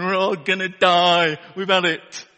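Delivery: doom-laden wail. Retold or imitated. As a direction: moan that all is lost, loud and slow. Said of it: "this guy in the back going".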